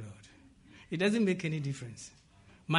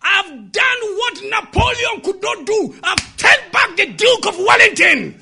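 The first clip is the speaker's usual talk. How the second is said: high, triumphant shout; boy shouting; 12 semitones higher, 12 dB louder than usual. Retold or imitated. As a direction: shout your victory out like an excited boy. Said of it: "he heard the boy shouting".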